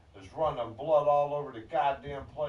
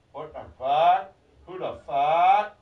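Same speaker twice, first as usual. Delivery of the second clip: loud mocking echo; 2 semitones higher, 10 dB louder than usual. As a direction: belt out each question, stretching the last word. Mock echo, drawn-out loud rising questions.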